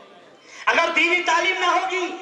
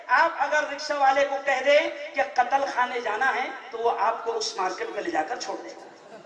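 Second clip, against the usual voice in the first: normal talking voice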